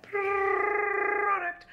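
high, drawn-out "product"; goofy high-pitched stretched word; oddly said exclamation. Real, not acted. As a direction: jump up high and hold the word like a silly note. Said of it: "I don't know why I said it like that".

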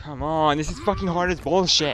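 loud, high frustrated outburst; frustrated yell. Real, not acted. Gamer yelling at a boss that keeps winning, loud and frustrated.

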